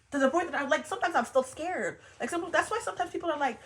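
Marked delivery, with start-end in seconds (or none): none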